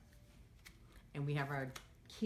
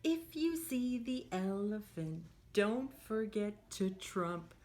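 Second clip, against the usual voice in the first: sing-song storybook read for little ones; lively picture-book voice; reading to children; 3 semitones higher, slower than usual. Acted. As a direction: read it like a teacher at story time. Teacher reads picture book aloud.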